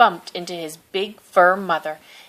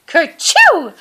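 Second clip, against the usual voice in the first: loud, high mock sneeze; a child's hammed-up sneeze, much higher and louder; 10 semitones higher, 7 dB louder than usual. A narrator's reading. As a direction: burst out a big high kerchoo. Fur child sneezes by river.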